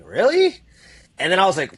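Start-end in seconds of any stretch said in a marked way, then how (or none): 0.0-0.6 high, rising incredulous question; surprised disbelief. Retold or imitated. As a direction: act out a startled double-take. Disbelieving reply to praise, retold.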